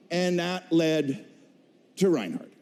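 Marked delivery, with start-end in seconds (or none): none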